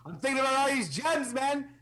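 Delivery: greedy gloating. Acted. Greedy character musing on gems.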